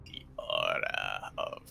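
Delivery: low, slow, breathy demon whisper. Pretend. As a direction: growl it like a demon from the depths. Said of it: "don't try and do that voice with your vocal cords".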